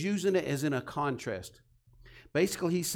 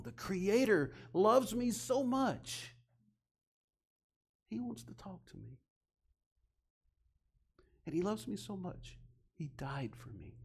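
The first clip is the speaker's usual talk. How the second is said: hushed and moved; 3 semitones higher, 6 dB quieter than usual. Real, not acted. Preacher confiding God's love to listeners, hushed whisper. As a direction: let it fall to a near-whisper of wonder.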